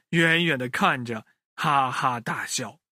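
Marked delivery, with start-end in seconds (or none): none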